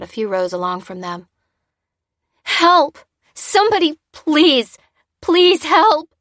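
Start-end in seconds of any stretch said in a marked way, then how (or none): 2.4-6.1 much higher, louder desperate screams; distraught cry for help. A narrator's reading. Distraught woman begs neighbours for help, screaming. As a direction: beg the crowd in panic. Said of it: "cried a distraught woman".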